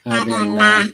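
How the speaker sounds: very high sped-up squeaky voice; chipmunk voice. Acted. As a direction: talk like a chipmunk. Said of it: "Who can understand chipmunk language?".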